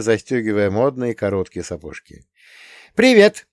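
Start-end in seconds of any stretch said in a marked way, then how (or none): 2.9-3.5 much higher, louder bright greeting; a schoolgirl chiming hello at the door; ringing girlish hello. A narrator's reading. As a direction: ring it out like a schoolgirl. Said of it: "вызвенел девичий голос".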